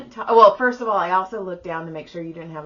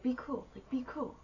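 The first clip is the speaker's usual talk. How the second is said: much softer self-talk, coaching herself; hushed inner pep talk; 4 semitones higher, 15 dB quieter than usual. Retold or imitated. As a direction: quietly psych yourself up. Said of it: "in my head, I was like".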